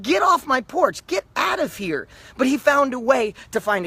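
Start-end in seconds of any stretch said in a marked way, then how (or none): 0.0-2.1 higher, slower mock shout of get off my porch; irritated homeowner. Homeowner chasing a salesman off the porch, higher, mock-angry yell. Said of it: "People are like".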